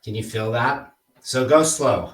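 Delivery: normal talking voice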